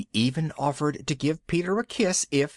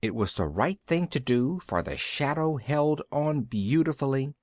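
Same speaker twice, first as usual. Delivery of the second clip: plain reading voice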